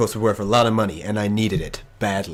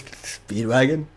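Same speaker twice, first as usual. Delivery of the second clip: breaking down; slower than usual. Acted. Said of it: "breaking down and goes".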